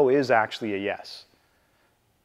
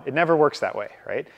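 ordinary delivery

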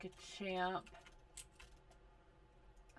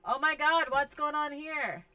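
exasperated outburst; 6 semitones higher, 9 dB louder than usual. Real, not acted. Alarmed at game chaos.

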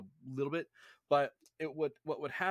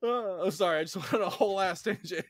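higher, louder, laughing through it; laughing apology; 6 semitones higher, 5 dB louder, faster than usual. Real, not acted. Laughing apology for own rant.

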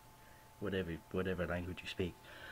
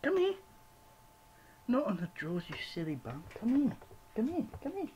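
much higher, sing-song call to the cat; coaxing cat call; 14 semitones higher than usual. Real, not acted. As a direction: call the cat up high and sweet. Calling the cat.

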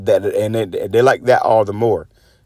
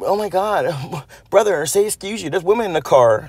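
higher, prim mock-scolding voice; mocking prissy friend; 6 semitones higher than usual. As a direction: squeal it like a fussy friend scandalised by a burp. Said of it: "acting like a bitch".

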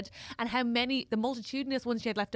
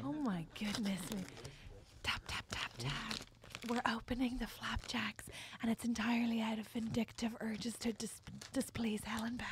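hushed, very soft microphone whisper; mock ASMR whisper; 3 semitones lower, 11 dB quieter than usual. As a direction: whisper it like a parody ASMR host. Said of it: "It's A S M R".

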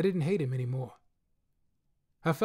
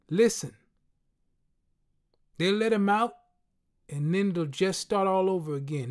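street friend, higher and louder, insisting; friend's voice, pressing; 3 semitones higher, 3 dB louder than usual. A narrator's reading. Friend insisting to the narrator that it starts again. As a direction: push the point home as the friend.

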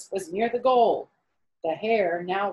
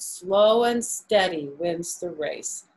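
slow and steady; slower than usual. Shown as a demonstration. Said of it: "what's the cliche that I learned".

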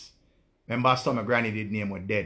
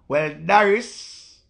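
drawn-out sarcastic address; 5 semitones higher, 6 dB louder, much slower than usual. Real, not acted. Sarcastic reply to viewer.

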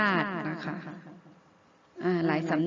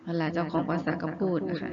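ordinary talk, nothing marked

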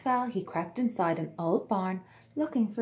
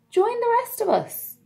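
high, loud friendly invitation; kind swan; 13 semitones higher, 7 dB louder than usual. A narrator's reading. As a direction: welcome him in warmly.

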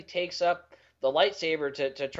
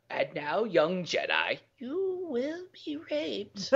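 high, slow, sinister creep; drawn-out creepy villain; creepy Emperor voice; 5 semitones higher, 5 dB quieter, slower than usual. Pretend. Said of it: "That was creepy".

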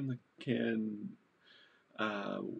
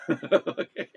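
a louder, laughing "Okay?" that rises high; amused okay after the Facebook joke; 5 semitones higher, 10 dB louder than usual. Real, not acted.

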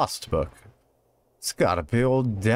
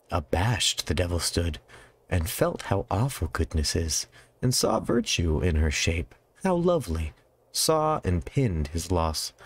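reading poetry aloud; 4 semitones lower than usual. Acted. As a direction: read the old verse with care. Reads Milton passage aloud.

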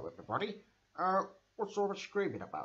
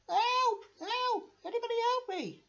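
much higher squeaky calls for help; high cries for help. Acted. Kidnapped toy calling for rescue, squeaky cries. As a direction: cry out like a small frightened toy.

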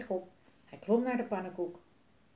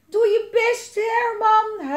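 high, loud urging wail; 13 semitones higher, 10 dB louder, much slower than usual. A narrator's reading. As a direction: cry out desperately to someone far below. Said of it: "huilde ze".

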